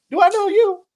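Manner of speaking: put-on high, prim old lady asking. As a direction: pitch it up like a suspicious old woman. Old lady voiced in story.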